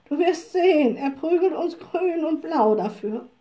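high, emphatic horse voice; worried objection. A narrator's reading. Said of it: "wandten die Pferde ein". (besorgt warnend, mit hoher Stimme)

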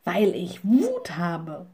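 slow, furious emphasis; cross little witch, drawn out; glaring anger. A narrator's reading. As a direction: draw out the rage word by word. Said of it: "Sie blitzte den Raben an".

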